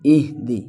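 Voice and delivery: "اہدی" with hamza joined to a still h; joined clear ha. Shown as a demonstration. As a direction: close the first syllable of "اہدی" on h. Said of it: "ملا کر پڑھیں گے". ("اہدی" ہا کو ملا کر)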